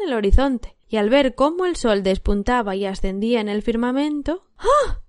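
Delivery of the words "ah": sharp high gasp of an idea; a bright "ah" as the thought strikes; sudden eager "ah"; sudden realization. A narrator's reading. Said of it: "pensó súbitamente".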